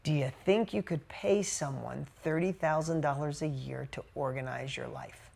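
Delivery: hushed, low retold question; soft, pointed question. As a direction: drop the voice and let the question sink in. Gently delivering the key question.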